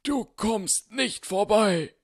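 hammed-up wizard battle cry; posing as Gandalf. Dumbledore striking a Gandalf pose for Harry, hammed-up and booming. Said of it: "hielt ihn hoch und posierte".

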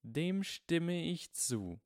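put-on slow, high, icy older woman. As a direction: freeze them with each word. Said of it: "sagte eine eisige Stimme".

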